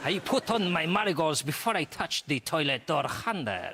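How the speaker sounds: lightly put-on Spanish lilt, laughing; the Spanish detective explaining himself; mock Spanish accent.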